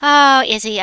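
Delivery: ordinary talk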